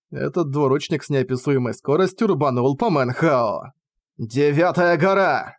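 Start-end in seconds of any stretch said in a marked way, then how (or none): 4.2-5.5 slow, drawn-out cry of a technique name; exclaimed battle cry. A narrator's reading. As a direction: call out the move slowly. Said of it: "воскликнул Манхао".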